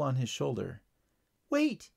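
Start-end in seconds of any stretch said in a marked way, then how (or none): 1.5-1.9 very high tiny bug voice; insistent ladybug. A narrator's reading. Ladybug urges the boy to return, insistent high voice. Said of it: "insisted the ladybug".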